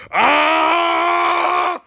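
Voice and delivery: high, loud dying shriek; agonized scream. Acted. Freddy's mind fails, a loud high scream. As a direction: scream as your head bursts. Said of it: "And Freddy just goes".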